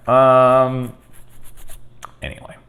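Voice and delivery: ordinary delivery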